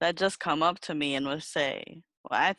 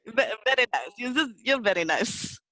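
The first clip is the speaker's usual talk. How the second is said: mock Indian accent; 5 semitones higher than usual. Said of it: "That's more Indian".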